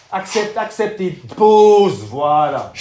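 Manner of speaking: slow, loud urging; loud coaching. Real, not acted. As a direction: push him through the rep. Coach urges during effort. (encouragement fort et lent)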